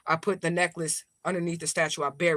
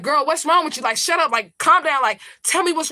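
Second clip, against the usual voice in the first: exasperated reply; 8 semitones higher, 6 dB louder than usual. Retold or imitated. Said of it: "I'm like".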